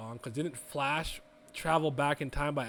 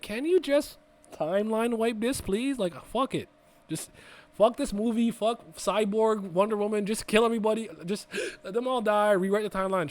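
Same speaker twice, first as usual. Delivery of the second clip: higher, mock fed-up pleading; exasperated rant; 6 semitones higher, 4 dB louder than usual. Viewer begging the movie to wipe the timeline, exasperated. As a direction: play up being done with the whole movie. Acting out his thoughts during movie.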